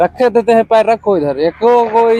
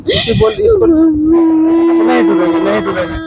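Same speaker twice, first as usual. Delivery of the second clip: mock whimper; 7 semitones higher than usual. Teasing mock crying.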